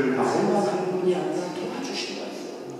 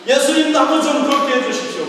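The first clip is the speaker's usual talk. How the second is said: pleading Peter; 7 semitones higher, 5 dB louder than usual. Acted. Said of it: "자기도 물 위를 걸을 수 있을 것 같은 믿음".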